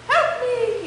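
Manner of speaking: high, mock cry for help; playful cry for help. Unicorn playing rescue games alone, mock cry. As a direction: squeak out a pretend rescue plea. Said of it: "he'd cry".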